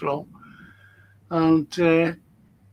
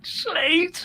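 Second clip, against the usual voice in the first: high, laughing through the words; can't stop giggling while apologising; laughing apology; 9 semitones higher than usual. Real, not acted. Laughing fit over [name].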